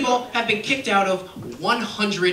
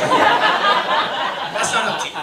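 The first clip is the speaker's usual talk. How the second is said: mock stern; 6 semitones higher, 5 dB louder, much slower than usual. Mock scolding the bald friend.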